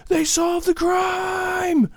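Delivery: very high, slow shouting dude; mock fanboy squeal. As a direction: squeal about a TV star like a superfan.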